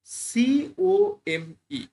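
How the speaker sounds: drawn-out letters for students; teacher spelling সিওএমই slowly. Shown as a demonstration. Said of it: "এই ওয়ার্ডটাতে দেখো".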